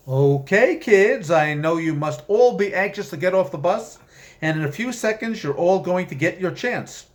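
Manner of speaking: high, loud chipper bus-guide voice; cheery demon tour guide. Acted. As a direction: boom it over the PA like a peppy tour guide.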